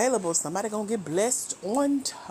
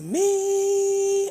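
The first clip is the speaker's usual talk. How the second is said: drawn out; 9 semitones higher, 5 dB louder than usual. Real, not acted. Slowly reading a comment.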